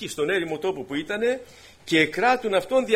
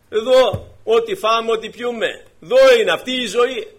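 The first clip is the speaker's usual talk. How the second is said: booming mock creed; 6 semitones higher, 8 dB louder than usual. Preacher booms unbeliever's creed.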